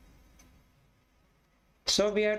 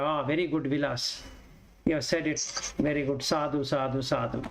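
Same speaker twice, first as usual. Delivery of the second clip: lower, lightly voiced approving audience; mock-praise the reciter, sadhu sadhu; imitating praising listeners; 5 semitones lower than usual. Teacher imitating the audience applauding his recital, lightly mock approving. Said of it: "you will clap hand and say that".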